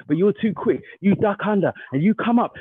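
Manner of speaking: ordinary delivery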